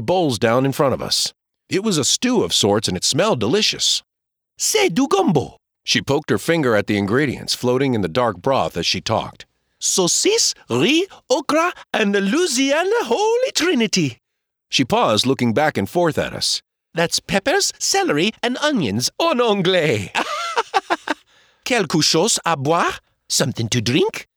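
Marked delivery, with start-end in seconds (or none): 4.6-5.6 high, jolly Cajun woman; French waitress. Acted. Waitress serving gumbo, high and jolly. 9.8-20.1 French waitress. Acted. Waitress naming gumbo ingredients, high Cajun voice. 20.1-21.1 shrieking high giggle; a bright high laugh from the waitress; high jolly laugh. Acted. Waitress laughing at her joke, high giggle. 21.6-24.3 French waitress. Acted. Waitress offering drinks, high French voice.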